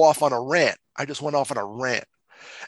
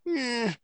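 high choked groan; 10 semitones higher, 5 dB quieter than usual. Retold or imitated. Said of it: "I'm like".

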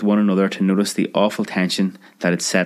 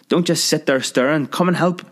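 high, urgent plea; high frantic call for help; urgent cry; 8 semitones higher than usual. Acted. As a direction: rush them to help. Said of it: "cried the ancient half-sister".

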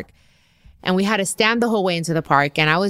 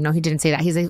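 ordinary talk

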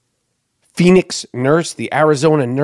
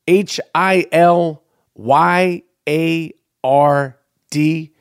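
slow, letter-by-letter spelling; deliberate spelling with a gap between letters; spelling out slowly; much slower than usual. Real, not acted. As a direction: draw out each letter so listeners can follow. Spelling out a surname for listeners.

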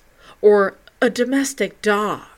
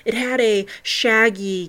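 ordinary delivery

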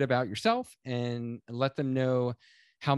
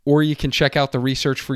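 ad read; 8 dB louder than usual. Acted. Host reading sponsor ad.

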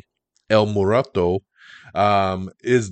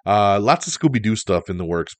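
ordinary talk, nothing marked

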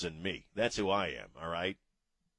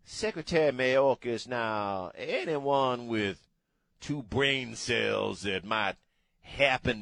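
dripping Louisiana accent. Shown as a demonstration. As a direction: ramble and embellish in a thick Louisiana accent. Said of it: "when you got a thick, dripping Louisiana accent".

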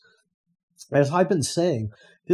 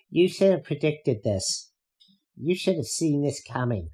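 a lightly put-on, slower scolding pundit voice; mocking media pundit; 3 semitones higher than usual. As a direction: sneer it like a smug reporter lecturing. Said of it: "that's exactly their tone of voice".

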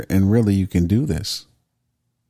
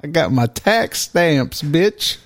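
exaggerated Southern twang, pitched higher and proud; Southern accent; 6 semitones higher, slower than usual. Host playing the busted dealer, boasting in a Southern accent. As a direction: crow it like a cocky Southern dealer. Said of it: "doing, like, a Southern accent".

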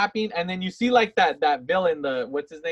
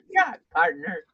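hammed-up wounded cowboy; mock dying cowboy. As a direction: play a cowboy who's been shot. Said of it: "he turns into a cowboy".

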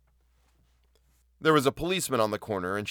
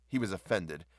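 plain reading voice

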